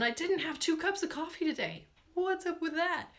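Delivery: high-pitched comic indignation; playful, incredulous aside; mock bafflement. Real, not acted. Jokes about being scattered without coffee.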